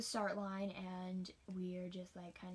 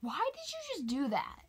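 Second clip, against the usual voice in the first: high, louder indignant question; shocked confrontation; 9 semitones higher, 5 dB louder than usual. Retold or imitated. Runner confronting a mean boy, high and indignant. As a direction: demand it in disbelief. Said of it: "And I'm like".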